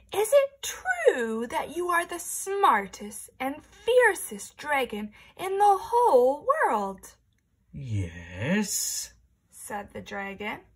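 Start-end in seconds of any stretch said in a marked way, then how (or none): 0.1-7.2 high, slow, sweet flattery; butter him up in a high voice; princess voice. Acted. Princess flattering the dragon, high and slow. 7.7-9.1 very low, slow booming dragon. Acted. Dragon talking to the princess at his door, deep and slow.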